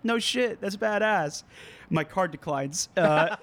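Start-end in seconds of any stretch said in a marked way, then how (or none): 0.0-1.4 pitched-up hyped reply; imitating own hyped reply; mock excited reply. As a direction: play it up as a thrilled musician. Retold reply to fan.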